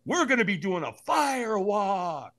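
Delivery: high, slow, drawn-out announcement; big reveal. Retold or imitated. Buddy revealing the fire walk, excitedly drawn out. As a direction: stretch each word to sell the surprise.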